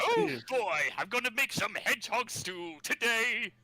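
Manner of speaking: high, gleeful cartoon villain. Quoting Robotnik cartoon line.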